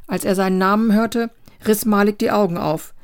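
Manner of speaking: usual speaking voice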